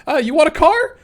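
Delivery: squeaky, overeager salesman mascot pushing freebies; Clocky voice. Retold or imitated. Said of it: "We have Clocky in there going".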